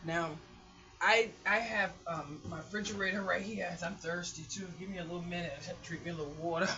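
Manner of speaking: ordinary talk, nothing marked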